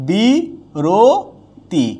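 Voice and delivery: বিরতি stretched slowly, syllable by syllable; slow demo pronunciation. Shown as a demonstration. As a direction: drag out বিরতি slowly as a model. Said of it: "teacher demonstrates pronunciation".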